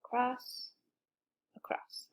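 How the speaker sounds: soft unvoiced whisper of across. Shown as a demonstration. Teacher tracing the letter in the air, whispering across. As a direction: breathe the word across as you trace. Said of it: "Let's try writing it in the air together".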